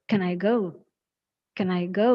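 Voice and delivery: "Can I" clubbed quickly into one unit, then "go"; run together. Shown as a demonstration. Teacher modelling the question for students, run together. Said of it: "it should be like".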